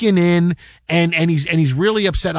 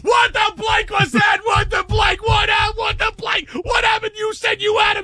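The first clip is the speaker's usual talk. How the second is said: high, shouted producer rage; mock furious yell. Producer screaming at staff after the prank call, mock furious yelling. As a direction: yell at the staff, hammed-up fury.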